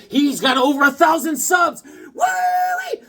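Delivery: loud high whoop of celebration; excited cheer. Real, not acted. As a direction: shout it out like a big win. Celebrating a subscriber milestone.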